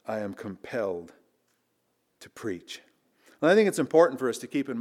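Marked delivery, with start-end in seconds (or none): 0.1-2.8 soft, lower, almost fading reprise; hushed reflection. Acted. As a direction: let it sink in, nearly under breath. Quiet reprise of Paul quote.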